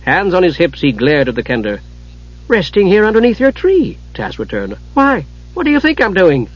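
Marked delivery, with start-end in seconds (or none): 2.5-4.0 lightly voiced Kender, pitched up; breezy Kender. A narrator's reading. Kender answers the cross dwarf, breezily innocent. 4.9-6.5 higher-pitched, curious comeback; lightly voiced Kender, asking innocently. A narrator's reading. Kender turns the dwarf's question back, innocently curious.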